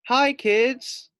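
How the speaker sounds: high, loud sing-song greeting; cheerful driver. Acted. Driver Carolina greets the class in a high loud cheery hello. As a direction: call out a bright hello to the kids. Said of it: "said Carolina".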